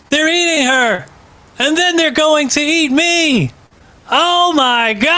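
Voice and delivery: high, frantic horror-movie wail; movie character panic. Retold or imitated. Quoting the Troll Two kid, high panicked wail. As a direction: shriek it like a B-movie victim. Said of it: "He says it like that".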